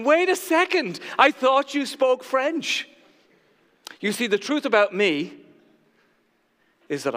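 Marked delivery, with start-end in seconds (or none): 0.0-2.9 high-pitched imitation of incredulous wife; indignant disbelief. Wife looking at husband, high incredulous protest. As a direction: act out a stunned challenge, slowing at the end. Wife confronting him in the story.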